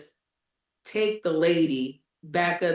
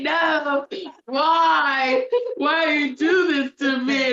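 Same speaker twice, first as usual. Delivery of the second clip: high, wailing whine, imitating a crying child; mock crying toddler; 10 semitones higher, 5 dB louder, slower than usual. Mother imitating her daughter crying on the way to dad's house, high wailing mock sob. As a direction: ham up a little kid's sob of protest, pitched well up and loud. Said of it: "She starts crying".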